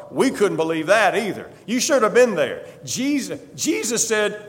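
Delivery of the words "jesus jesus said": ordinary delivery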